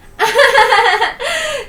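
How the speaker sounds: bright, loud falling laugh; loud high laugh. Acted. Sister laughing about the tough school, loud high laugh.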